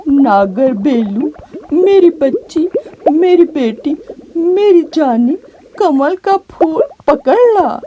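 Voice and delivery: grieving father begging his daughter onward, tearful; wiping away tears. Acted. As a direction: urge her on while holding back tears. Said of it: "अपने आंसू पूंछते हुए कहा".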